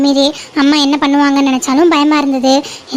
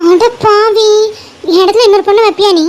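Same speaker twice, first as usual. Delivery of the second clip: shocked outrage; 5 semitones higher than usual. Acted. Husband jokes about remarrying.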